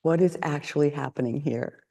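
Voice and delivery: a soft, deliberate stop; quiet self-check. Retold or imitated. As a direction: hush yourself to a halt. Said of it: "Also out loud".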